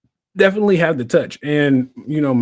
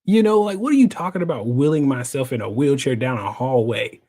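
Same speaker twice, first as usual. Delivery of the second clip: ordinary talk, nothing marked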